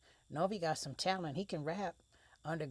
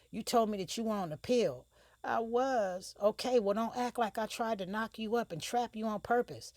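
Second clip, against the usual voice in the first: higher and louder, heated retort; angry argument; 7 semitones higher, 6 dB louder than usual. Acted. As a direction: snap back at her, fed up.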